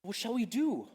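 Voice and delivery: high, loud bored whine; exasperated. Acted. Exasperated repeat, far higher, louder.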